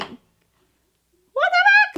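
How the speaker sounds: very high-pitched mock disbelief; startled squeak. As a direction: squeak it out in retold shock. Startled reaction in hallway, retold.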